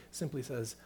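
usual speaking voice

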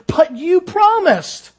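high, slow, pleading cry. Acted. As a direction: plead in disbelief after the bite. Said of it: "he pleaded".

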